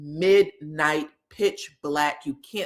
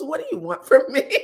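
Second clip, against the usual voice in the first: laughing; 6 semitones higher, faster than usual. Retold or imitated. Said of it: "he goes".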